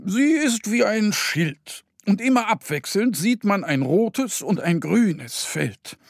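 put-on high, gentle old owl voice; wise owl. Owl regretting there is no traffic light, high voice.

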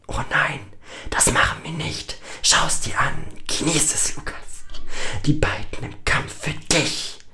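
high, whispery sinister voice; taunting inner voice. A narrator's reading. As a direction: gloat in an eerie half-whisper. Voice in his head returns. (hämisch flüsternde innere Stimme)

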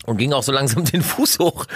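ordinary talk, nothing marked

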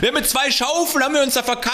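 high, mock bragging worker voice; excavator driver voice. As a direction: boast about the shovels, squeaky. Continues excavator driver impression.

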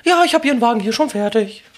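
high, fast, loud saleswoman voice. Retold or imitated. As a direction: chirp it like a busy saleswoman. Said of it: "dann kam die Frau mit".

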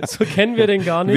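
talking through a laugh. Real, not acted. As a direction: laugh as you say it. Laughs at own joke.

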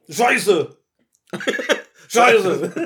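put-on, hammed-up swear; exaggerated play-acted exclamation, laughing; mock cursing. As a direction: send up the curse in a silly voice. Mocking the suggested exclamation repeatedly.

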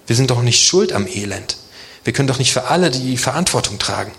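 usual speaking voice

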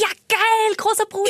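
put-on high, gleeful little-kid cheer; thrilled kid voice, laughing; childlike glee. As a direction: squeal with delight like a small child.

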